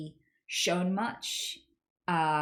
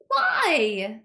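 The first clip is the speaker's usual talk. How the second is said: loud, higher-pitched exasperation; exasperated cry; 4 semitones higher, 8 dB louder than usual. Real, not acted. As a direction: burst out in sheer bafflement. Exasperated at a repeated assumption.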